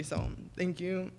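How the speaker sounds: choked thanks through tears; real crying. Real, not acted. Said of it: "I'm sorry, this is an ugly cry".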